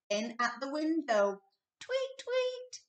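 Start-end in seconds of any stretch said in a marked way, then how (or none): 1.8-2.8 very high chirping; birdsong tweet. A narrator's reading. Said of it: "went the birds".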